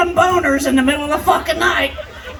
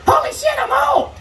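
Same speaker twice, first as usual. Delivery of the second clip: shocked realization; 10 semitones higher than usual. Retold or imitated. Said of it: "I'm thinking to myself".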